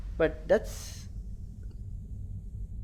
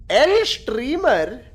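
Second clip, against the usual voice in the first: mock drawl; 6 semitones higher, 6 dB louder, much slower than usual. Mocking chat's jab.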